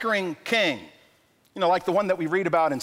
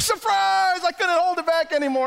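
blurted surprise; 11 semitones higher than usual. Retold or imitated. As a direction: burst out unable to hold it in. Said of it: "it's like".

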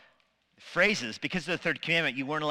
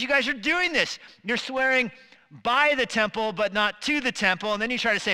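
high, loud preacher's rebuke; emphatic rebuke; 8 semitones higher, 5 dB louder than usual. Acted. Jesus rebuking people who swear by the temple, loud and high. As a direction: call them out on their word games.

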